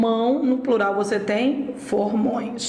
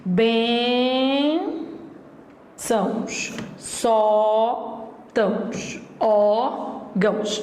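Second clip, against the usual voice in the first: slow word list; much slower than usual. Shown as a demonstration. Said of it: "Então, tem isso, ó".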